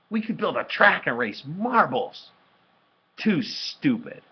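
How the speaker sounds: high, loud pitch of an idea, then curt dismissal; voiced sibling back-and-forth, pitched high and loud; dismissive exchange. A narrator's reading. Brother shooting down a game idea, dismissive.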